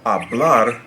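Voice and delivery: "hablar" stressed on its final syllable; light start, then weight on the last syllable of "hablar"; slow, deliberate "hablar" leaning on the ending. Shown as a demonstration. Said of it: "the natural stress there is on the".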